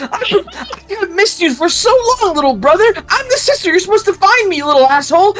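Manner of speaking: very high, loud laughing squeal; laughing big sister. Acted. Sister hugging her brother, laughing high squeal.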